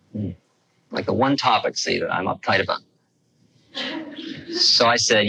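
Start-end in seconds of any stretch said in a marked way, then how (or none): none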